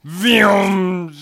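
loud mock engine-like vroom; vroom sound effect. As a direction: make a quick vroom noise.

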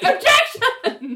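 very high, drawn-out mock tantrum; hammed-up exasperated screech, half laughing; foot-stamping protest. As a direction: squeal it slowly like a petulant lawyer. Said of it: "Richard is stamping his foot like".

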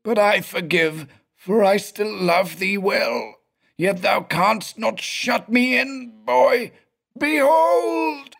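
high, unearthly blubbering wail; sobbing spirit voice. Acted. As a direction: weep it like a reproachful ghost. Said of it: "sobbed the blubbering spirit voice".

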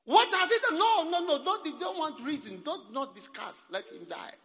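high, loud shouted preaching; full-force preaching. Real, not acted. Preacher thundering at the congregation about the crowd. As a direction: hammer the crowd's madness home.

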